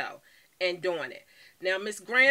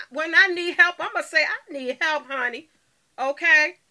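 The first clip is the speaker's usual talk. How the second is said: louder and higher, owning the need for help; emphatic loud insisting; 7 semitones higher, 7 dB louder than usual. Real, not acted. Tells her viewers proudly she asks for help, loud and emphatic. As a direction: declare it boldly, no shame.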